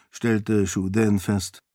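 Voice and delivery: usual speaking voice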